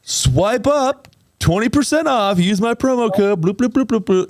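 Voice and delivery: high, singsong sales pitch; mock influencer promo. Host parodies himself selling at thirty-eight, high mock influencer pitch. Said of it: "being like".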